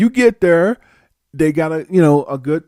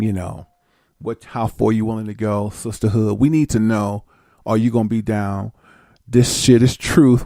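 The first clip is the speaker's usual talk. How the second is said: low stern recruiter; 4 semitones lower than usual. Retold or imitated. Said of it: "And say".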